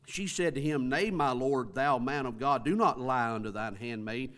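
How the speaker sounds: loud, declaiming King James reading; scripture reading. Acted. Preacher reading the Shunammite's words to the church, loud and declaiming. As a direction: proclaim the old words to the church. Reads scripture aloud with force.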